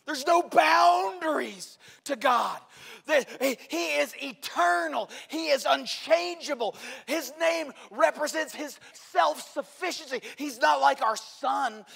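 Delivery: loud, high, ringing sermon voice; full-force preaching. Acted. Pastor preaching to the congregation about God, loud and ringing. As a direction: proclaim it to the whole church.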